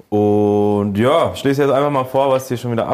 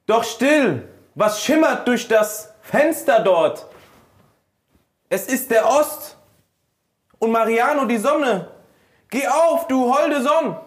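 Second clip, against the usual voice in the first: high, slow, overblown stage actor; theatrical recital; 12 semitones higher, slower than usual. Pretend. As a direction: declaim it like a ham actor. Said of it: "sehr theatralisch bitte".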